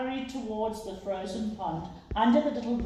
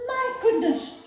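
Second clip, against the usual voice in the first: very high startled squeal; girl's high startled squeak; surprised cry; 4 dB louder than usual. A narrator's reading. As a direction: shriek it out in shock. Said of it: "she cried".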